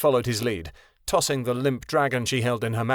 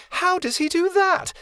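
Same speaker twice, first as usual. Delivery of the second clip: much higher, breathless with wonder; complete awe. A narrator's reading. Melody staring up at the dragon, asking in awe. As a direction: gape at the dragon and ask in awe. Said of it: "Melody asked in complete awe".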